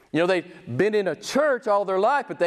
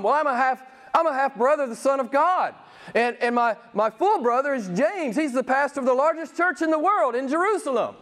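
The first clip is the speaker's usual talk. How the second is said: ordinary talk, nothing marked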